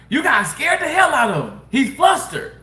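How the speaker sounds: mock his amused laughing tease; laughing while talking. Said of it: "Laughing hard, y'all, and says".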